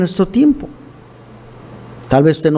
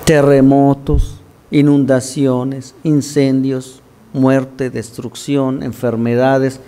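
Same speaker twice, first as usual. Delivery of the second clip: slow solemn list; 4 semitones lower, much slower than usual. Acted. Preacher lists disasters slowly.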